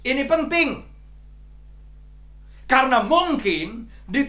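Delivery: loud, high, forceful sermon delivery; preaching at full force. Acted. (berkhotbah dengan lantang dan penuh semangat)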